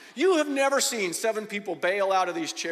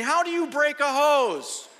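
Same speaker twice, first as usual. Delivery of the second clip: incredulous; 7 semitones higher, 5 dB louder, slower than usual. Retold or imitated. Acted disbelief at broken hose.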